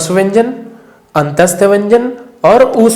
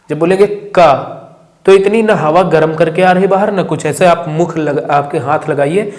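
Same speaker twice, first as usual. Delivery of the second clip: normal talking voice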